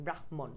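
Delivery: ব্রাহ্মণ as brah-mon; ব্রাহ্মণ with the h before the m; half h, full m. Shown as a demonstration. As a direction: breathe the h in ব্রাহ্মণ, then sound mon fully. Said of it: "অর্ধেক, তারপর একটা ম ফুল".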